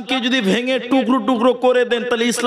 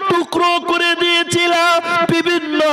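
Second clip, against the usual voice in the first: impassioned preaching; 8 semitones higher, slower than usual. Acted. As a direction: hammer it home from the pulpit. Fiery preaching climax.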